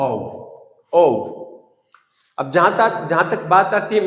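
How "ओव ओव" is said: "ओव" closing on v; correct pronunciation. Shown as a demonstration. Teacher gives learners the right sound, "ओव" with v. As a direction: end "ओव" on a voiced v. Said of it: "इसका सही उच्चारण होता है".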